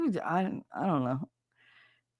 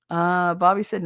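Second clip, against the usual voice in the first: usual speaking voice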